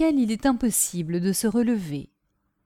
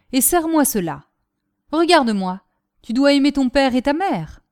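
lightly voiced commanding father, louder; a touch of paternal authority, pitched up; stern father; 5 semitones higher, 5 dB louder than usual. A narrator's reading. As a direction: give the father firm, raised orders.

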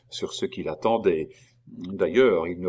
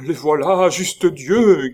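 high, loud panicked shout; terrified cry; 8 semitones higher, 8 dB louder, slower than usual. A narrator's reading. Gorenflot cries out in terror as men approach. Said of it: "cria Gorenflot".

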